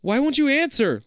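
high, loud frustrated yell. Acted. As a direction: yell at someone unresponsive. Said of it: "Liz yells".

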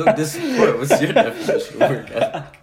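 high, loud, laughing jab; laughing tease. Real, not acted. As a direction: tease him while cracking up.

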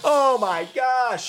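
high, loud, slow exclamation; mock shock. Retelling the package going off, loud mock shock. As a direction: cry out in drawn-out, hammed-up horror.

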